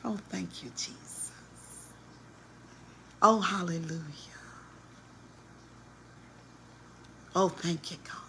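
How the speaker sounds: very soft imitation of devout murmuring; mock pious praise. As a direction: breathe out hushed, sanctimonious praise. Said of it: "when you see her".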